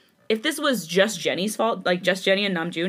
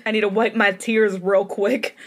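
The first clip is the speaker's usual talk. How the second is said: plain reading voice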